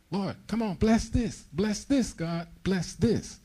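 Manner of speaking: high, whining plea to God, mocking the believer; whiny begging believer. Preacher mocking believers who beg God to bless their plans, high whining plea. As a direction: beg like a spoiled child asking for a blessing.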